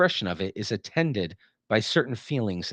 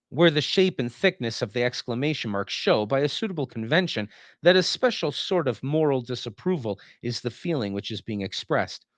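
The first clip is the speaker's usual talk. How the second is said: ordinary talk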